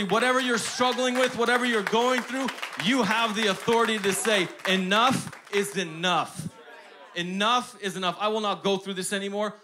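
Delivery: fiery sermon climax; preaching. Acted. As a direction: drive it home to the congregation. Preaching at full force.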